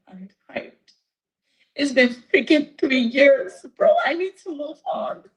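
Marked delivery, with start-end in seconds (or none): 1.7-5.2 high, crying, real exasperation; tearful plea. Real, not acted. Woman begging to move on after three years, tearful. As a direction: plead through real tears. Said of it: "I can't get with the tears right now".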